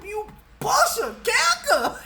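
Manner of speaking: high, loud urging to kick; egging her on. Retold or imitated. Urging her sister to fight back, high and loud. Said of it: "I told my sister".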